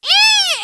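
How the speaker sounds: a sudden high-pitched eee of alarm; high panicked squeal. Acted. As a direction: squeal like you just got startled. Skeletons launched across arena.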